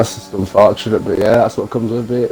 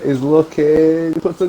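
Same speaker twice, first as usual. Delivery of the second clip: excited; 6 semitones higher, slower than usual. Real, not acted. Live overtake attempt.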